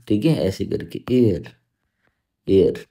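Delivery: normal talking voice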